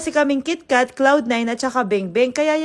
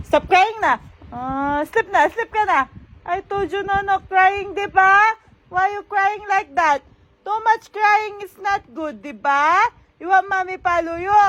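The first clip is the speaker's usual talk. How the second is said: high, sing-song telling-off; 7 semitones higher than usual. Real, not acted. Mother tells her crying baby to sleep, high baby-talk scolding. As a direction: chide a little one in a high voice.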